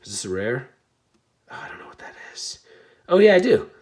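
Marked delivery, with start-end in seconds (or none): 1.5-2.6 fast unvoiced whisper to himself; quick breathy aside. Real, not acted. 3.1-3.7 high loud burst of recognition; sudden delighted oh yeah; sudden realisation. Real, not acted.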